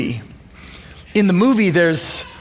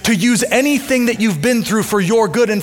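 higher, fervent preaching build; 4 semitones higher than usual. Acted. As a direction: drive the message home to the congregation. Preaching climax.

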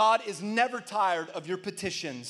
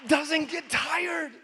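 high, drawn-out pulpit cry with pauses; ringing sermon declaration; emphatic preaching; 8 semitones higher, 8 dB louder, much slower than usual. Real, not acted. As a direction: proclaim it big and let it hang. Preacher proclaims slowly after pause.

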